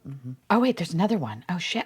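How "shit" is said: usual speaking voice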